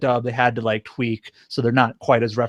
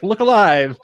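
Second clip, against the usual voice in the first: higher, lightly hammed-up call; corny hero voice; 6 semitones higher, 4 dB louder, slower than usual. Fan quoting Speedwagon's sudden entrance, corny voice. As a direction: call it out with mock-heroic corn. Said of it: "in his usual corny voice".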